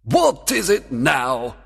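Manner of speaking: slow, high exasperated question; demanding. A narrator's reading. Fairy king questions his giggling servant, demanding. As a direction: demand an answer, drawing it out. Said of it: "demanded the king".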